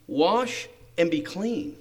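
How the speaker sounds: slow, measured delivery of "Wash and be clean"; measured instruction. Acted. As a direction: spell out the simple instruction unhurriedly. Servant repeats simple instruction.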